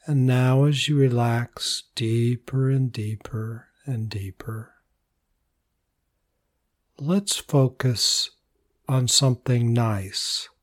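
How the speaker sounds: calm, unhurried voice with long pauses; soothing meditation lead, phrases trailing softly; guided meditation. Real, not acted. Leads a body relaxation meditation.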